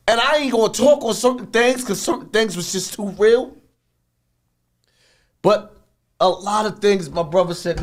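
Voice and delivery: normal talking voice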